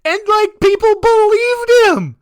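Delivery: high, loud disbelieving cry; incredulous outburst. Real, not acted. Host aghast that people believed him, incredulous. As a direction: voice shoots up, aghast that anyone fell for it.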